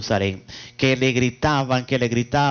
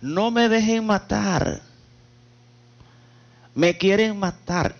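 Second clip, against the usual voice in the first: higher, drawn-out plea with no real shout; mild mock yell, pitched up; retold cry for help; 5 semitones higher, slower than usual. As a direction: plead for your life in a preacher's retelling. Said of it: "Y gritaba".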